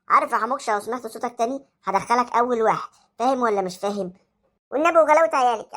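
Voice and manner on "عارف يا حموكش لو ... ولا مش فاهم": low, threatening teacher voice; teacher scolding a noisy boy; stern teacher. Acted. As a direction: warn him you'll send him first.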